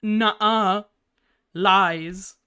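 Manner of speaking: usual speaking voice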